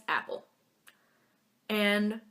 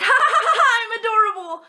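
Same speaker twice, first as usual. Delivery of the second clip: much higher, louder teasing laugh; mock cackle; 11 dB louder than usual. Mimi steals glasses and runs off, mock cackling. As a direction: cackle like a thief running off. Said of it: "be like".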